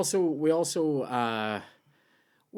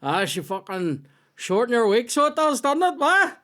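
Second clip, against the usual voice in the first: high, rising broad Northern Irish banter; coded banter line in a thick local accent; 11 semitones higher than usual. Retold or imitated. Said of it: "So just say".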